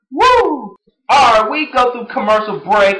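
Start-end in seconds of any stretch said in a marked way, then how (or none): 0.1-0.8 woo. Real, not acted. Celebrating after singing.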